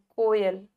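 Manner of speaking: कोयल with a rounded, narrow ओ; कोयल with closed, round first vowel; small-mouthed vowel. Shown as a demonstration. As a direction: barely open the mouth on को in कोयल. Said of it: "छोटा सा मुंह खुल रहा है".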